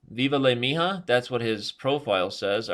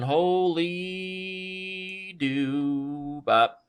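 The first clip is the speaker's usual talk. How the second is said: long wailing exclamation at a blunder; stretched exclamation; 8 semitones higher, much slower than usual. Real, not acted. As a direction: stretch HOLY and DOO way out. Exclaims after blundering a piece.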